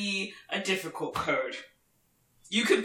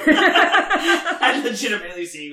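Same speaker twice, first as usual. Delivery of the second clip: laughing; 7 dB louder than usual. Real, not acted. Admitting her students' suspicion to co-host, laughing. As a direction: laugh through the words, louder. Amused at her own spy talk.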